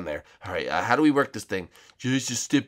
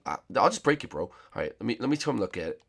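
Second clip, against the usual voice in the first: normal talking voice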